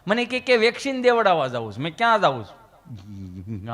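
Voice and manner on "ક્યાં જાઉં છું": much lower, slow drawn-out query; lower, slow mock of Gabho asking; mock dopey question. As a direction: play the slow dim friend.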